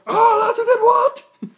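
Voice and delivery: high, loud shocked squawk; shocked old master. Retold or imitated. Imagining the guild master hearing the news, shrill shocked outburst. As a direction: imitate the master finding out, shocked. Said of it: "He'll be like".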